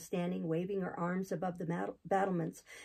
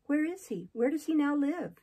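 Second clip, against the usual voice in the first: urgent; 7 semitones higher, 5 dB louder than usual. Acted. Jane's urgent questions, higher voice.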